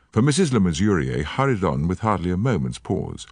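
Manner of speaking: usual speaking voice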